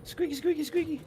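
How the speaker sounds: very high, loud squeal imitating a squeaky toy. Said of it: "She likes to make it go".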